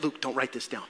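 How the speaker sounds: low, hushed acted aside; soft, secretive mock instruction; confidential aside. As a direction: slip it to the writer off the record. Mary's confidential aside to Luke.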